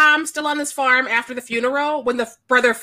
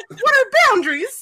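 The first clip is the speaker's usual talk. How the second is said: higher, incredulous questioning; mock bewilderment; 8 semitones higher than usual. Viewer questioning the farm's Tom, in mock bewilderment. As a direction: act baffled, pitched up. Mock-appalled reaction to the film.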